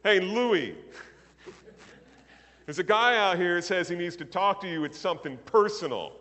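loud call to the back room; yelling to the back. Retold or imitated. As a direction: shout it toward the back room. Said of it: "he yells to the back room".

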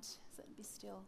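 slow whisper to herself; slow hushed self-command. Acted. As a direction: slowly whisper it, willing yourself still.

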